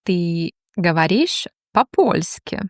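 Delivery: ordinary delivery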